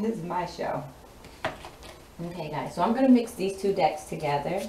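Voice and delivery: ordinary delivery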